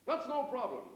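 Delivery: loud, high mock-American; American accent. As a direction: agree loudly in a put-on American accent. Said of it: "said our American friend".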